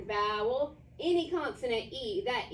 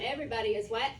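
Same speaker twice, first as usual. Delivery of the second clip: ordinary talk, nothing marked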